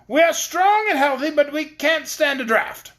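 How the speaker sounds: very high, loud, slow tiny-soldier retort; retorting paper soldier. A narrator's reading. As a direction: bristle back at the insult.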